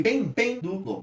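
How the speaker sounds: "pêndulo" slowly split, high on PEN then falling away; stressed first syllable. Shown as a demonstration. Teacher showing the tonic syllable, high stressed PEN. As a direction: repeat PEN, then PEN, du, lo descending. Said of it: "ele só decai depois".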